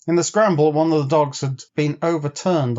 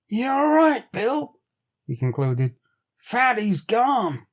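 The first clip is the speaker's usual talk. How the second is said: much higher, slow, grim frontier voice; grim Henry; 10 semitones higher, slower than usual. A narrator's reading. Henry confirming to Bill that Fatty is gone, grim. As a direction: confirm the loss heavily.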